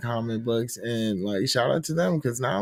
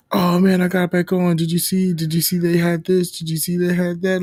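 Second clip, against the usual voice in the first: louder, higher mock gushing; excited kid imitation; 5 semitones higher, 6 dB louder than usual. Kids gush to him about Fortnite, imitated excitement. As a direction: imitate an eager fan bursting with questions.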